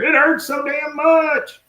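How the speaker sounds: high, drawn-out mock wail; pained wail. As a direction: cry out slowly in exaggerated pain. Tackled Dale heard crying out in pain.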